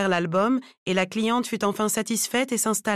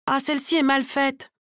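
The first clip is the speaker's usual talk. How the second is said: higher-pitched complaint; needlessly raised voice; 6 semitones higher than usual. A narrator's reading. Client complaining about her braid, needlessly raised voice. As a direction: pitch it up to fault the work. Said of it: "d'une voix plus haute que nécessaire".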